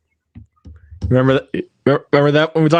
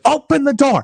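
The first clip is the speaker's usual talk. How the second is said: yelling; 14 semitones higher than usual. Retold or imitated. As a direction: shout it high through the door. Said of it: "She's like".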